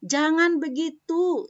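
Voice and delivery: firm prohibiting intonation; forbidding tone. Shown as a demonstration. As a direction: say "Jangan" firmly like a stern no. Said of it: "kalau kita melarang".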